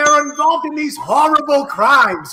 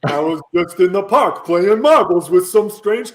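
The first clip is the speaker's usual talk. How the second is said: Yogi Bear voice; 5 semitones lower than usual. Acted. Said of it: "who else talks like that".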